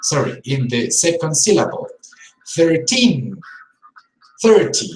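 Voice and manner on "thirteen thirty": put-on "Thirteen" stressed on teen, "thirty" louder on thir; stress contrast. Shown as a demonstration. Teacher modeling number stress for learners. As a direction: hit the stressed syllable hard. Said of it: "the stress has to go".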